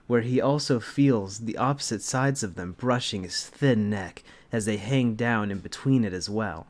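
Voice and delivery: normal talking voice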